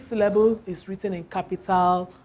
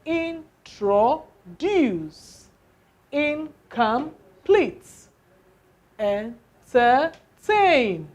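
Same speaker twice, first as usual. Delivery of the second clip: Introduce, Incomplete, Entertain, each drawn out very slowly; word stress drill; 3 semitones higher, slower than usual. Shown as a demonstration. Teacher modelling stress on the last syllable for students, slowly. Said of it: "the stress is on the what?".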